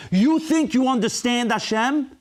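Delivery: high, rising rhetorical challenge; challenging question. Real, not acted. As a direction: confront the congregation with the question. Preacher challenges listeners rhetorically.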